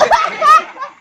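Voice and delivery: high squealing burst of laughter. Real, not acted. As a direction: break up laughing at the joke. Laughing again. (tawa melengking)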